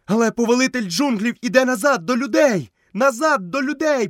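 high-pitched, insistent calling; повторний крик. A narrator's reading. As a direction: call it out high, like a herald. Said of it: "повторював сірий брат". (високий наполегливий крик)

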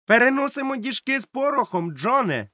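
very high-pitched sailor voice; sailor's reply. A narrator's reading. Sailor answers John about powder barrels, high-voiced reply. Sailor calls back to the cook.

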